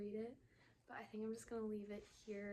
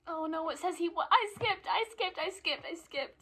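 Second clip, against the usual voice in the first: much higher and louder, frantic; panicked; 8 semitones higher, 13 dB louder than usual. Real, not acted. Reader realising she skipped ahead, frantic. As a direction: blurt it out in panic.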